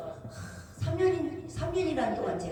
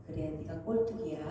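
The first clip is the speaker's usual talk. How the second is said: Jesus teasing; 6 semitones lower, 7 dB quieter than usual. Retold or imitated.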